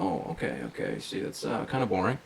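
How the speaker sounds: quiet, underwhelmed imitation; retold judging tone. Hairdresser judging her client's dull answers, mildly imitated and quiet. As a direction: drop the volume, mildly unimpressed. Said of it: "then she starts judging me".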